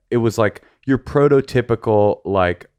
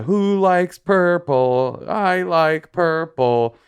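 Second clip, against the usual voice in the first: high, slow singsong; mock a children's entertainer; kids' song singer; 8 semitones higher, much slower than usual. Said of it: "singing kid songs".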